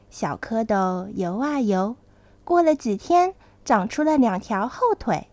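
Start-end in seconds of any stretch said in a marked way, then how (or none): none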